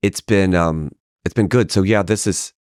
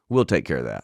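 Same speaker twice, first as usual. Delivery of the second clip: low dry assurance; 6 semitones lower, 5 dB quieter than usual. Retold or imitated. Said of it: "She goes".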